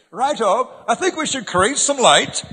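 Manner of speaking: high, hammed-up, prim request; mock polite God. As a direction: play God as a comically courteous asker. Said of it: "He didn't say".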